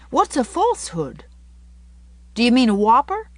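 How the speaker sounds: put-on high, curious boy voice; puzzled little boy. Davy asks Anne what a falsehood is, puzzled. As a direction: ask as a baffled child.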